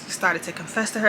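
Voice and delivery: normal talking voice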